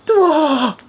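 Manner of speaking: high, loud wail of astonishment; overblown amazement. Acted. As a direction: cry out in theatrical disbelief. Amazed at not getting burnt.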